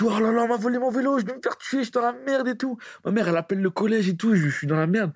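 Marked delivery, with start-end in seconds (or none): 0.0-2.8 much higher, hammed-up panicked kid; mock panic. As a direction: replay the schoolboy's panic in a high voice. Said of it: "Je dis". (panique imitée, voix aiguë)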